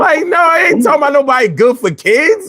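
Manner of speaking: high-pitched, incredulous protest; mock outrage. Scoffing at talk of being good for kids, high incredulous outburst.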